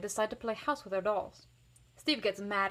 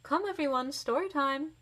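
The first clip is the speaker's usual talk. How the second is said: higher, louder call to the room; 6 semitones higher, 3 dB louder than usual. A narrator's reading. Miss Barnwell calling the kids to story time, raised. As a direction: gather the children. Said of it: "Miss Barnwell calls".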